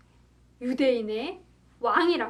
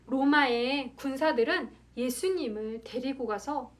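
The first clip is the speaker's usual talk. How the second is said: plain reading voice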